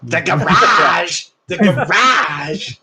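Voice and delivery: slow drawn-out Moe impression; mock Moe voice, slow and drawn; imitating Moe. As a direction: drag out "The garage!" like Moe. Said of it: "Mo says, like".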